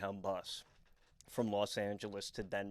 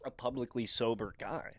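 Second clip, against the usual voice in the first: normal talking voice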